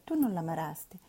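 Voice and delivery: ordinary delivery, nothing marked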